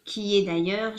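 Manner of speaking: normal talking voice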